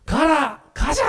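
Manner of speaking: high, called-out military order; command and response. Acted. The Lord commands and archangel answers, high commanding calls. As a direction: call the order, then the rallying answer. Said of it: "이렇게 하는 거죠".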